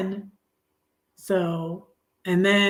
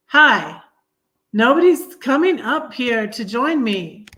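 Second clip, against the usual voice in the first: excited greeting; 5 semitones higher, 5 dB louder than usual. Real, not acted. Greeting viewer joining live stream.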